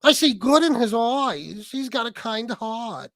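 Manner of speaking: slow, drawn-out mock New York accent; sarcastic New York accent impression, dragged out; mocking imitation. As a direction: mimic his New York drawl, stretching every word. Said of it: "And you're like".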